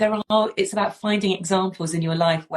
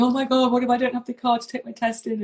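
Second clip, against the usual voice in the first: freaking out; 6 semitones higher than usual. Retold or imitated. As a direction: panicky teen voice, pitched up. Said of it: "Alfie was freaking out".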